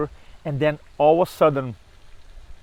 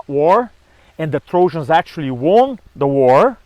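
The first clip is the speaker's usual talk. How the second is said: normal talking voice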